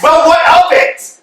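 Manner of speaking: loud indignant outburst; indignant shout. Acted. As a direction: shout it out high and slow. Angry retort in quarrel.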